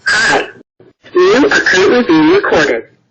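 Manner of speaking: loud recorded warning through speaker; doorbell speaker. Real, not acted. Loud recorded warning via camera.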